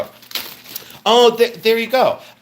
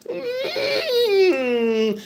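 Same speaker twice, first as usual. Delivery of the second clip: very high, long whimpering hum; drawn-out creature whine; Skeksis; 3 dB quieter than usual. Pretend. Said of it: "sounds like a Skeksis".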